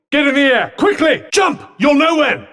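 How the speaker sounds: high, urgent battle shout; urgent shouted orders. Acted. Ordering a partner to jump mid-fight, urgent shout. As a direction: call out commands in a hurry.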